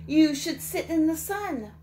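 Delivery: high, loud lazy sunbather; sunny critter advice; high animal voice. Acted. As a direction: invite him to bask. Animal advises Jim.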